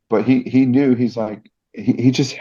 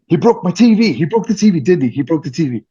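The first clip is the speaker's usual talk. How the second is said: mock panic; 9 semitones higher, faster than usual. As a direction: play up the dread that the TV is gone. Inner panic about the TV.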